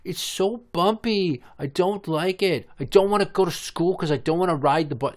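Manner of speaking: a hint of toddler whine; whiny toddler. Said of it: "little one looking out the window like".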